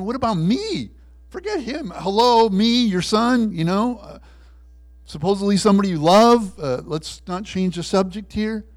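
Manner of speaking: loud, high, put-out complaint; indignant protest. Acted. Dan protests to God that he is forgotten, indignant. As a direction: protest like a slighted son demanding attention.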